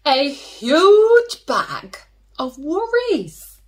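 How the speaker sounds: big storyteller reveal; dramatic reveal. A narrator's reading. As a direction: stretch out huge bag for drama.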